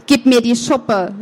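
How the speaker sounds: usual speaking voice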